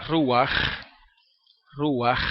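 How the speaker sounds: "ruach" ending in a rasped kh; long throat scrape closing "ruach"; strong final chet. Shown as a demonstration. Teacher illustrating chet at word end, strong guttural ending. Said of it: "tan fuerte como yo lo hago".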